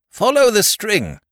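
very high, loud yell. A narrator's reading. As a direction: yell the direction out. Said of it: "yelled Bunter".

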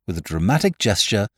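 ordinary delivery, nothing marked

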